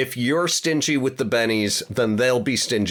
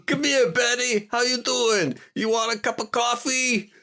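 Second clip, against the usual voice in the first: a terrible, hammed-up Brooklyn accent, much higher; 13 semitones higher than usual. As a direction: call across the street, Brooklyn accent, pitched way up. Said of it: "That is a Brooklyn accent, or my terrible".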